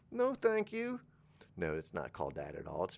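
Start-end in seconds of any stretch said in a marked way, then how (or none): 0.1-1.0 tiny, very high mock voice; declining client. Client turning down the trainer, high and small. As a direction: refuse in a small squeaky voice.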